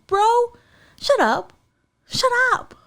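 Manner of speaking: very high-pitched, mock-outraged dismissal; exasperated scolding. Host telling off the absent bragging guy, exasperated. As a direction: squeal it in comic disbelief.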